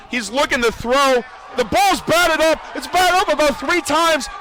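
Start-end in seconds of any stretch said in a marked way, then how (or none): 1.6-4.3 high, rising live call; excited play-by-play. Real, not acted. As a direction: bring the crowd up with the tip. Tipped ball live call.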